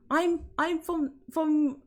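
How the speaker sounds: high, slow stab at Northern English; attempted Northern accent. Shown as a demonstration. Londoner failing to do the Northerners, halting Northern English. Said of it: "I can't even do it".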